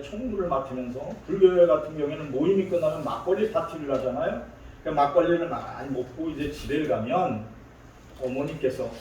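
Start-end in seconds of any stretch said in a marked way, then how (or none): none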